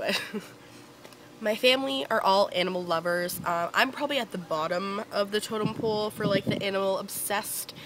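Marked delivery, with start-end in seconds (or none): none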